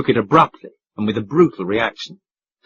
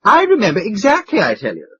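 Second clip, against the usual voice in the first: much higher, insistent and excited; excited cry; 7 semitones higher, 3 dB louder than usual. A narrator's reading. The duke insists to Mister Audley, excited. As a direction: insist eagerly. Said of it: "cried the duke excitedly".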